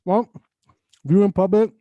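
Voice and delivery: talking with food in mouth; mouth full. Real, not acted. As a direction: speak through a mouthful. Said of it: "with the food in your mouth".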